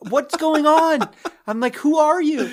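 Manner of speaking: high, loud startled yelling; shouting at an intruder through the window; alarmed shout. Retold or imitated. Man yelling at a stranger at his window, loud and alarmed. Woman breaking in at night.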